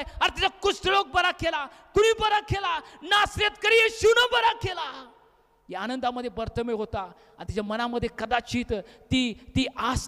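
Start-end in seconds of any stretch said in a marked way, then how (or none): 0.0-5.1 high, rising exclamation of wonder; amazed proclamation. Acted. Amazed townsfolk proclaim the healing.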